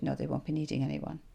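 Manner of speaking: ordinary talk, nothing marked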